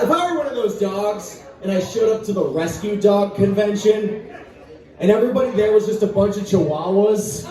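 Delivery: usual speaking voice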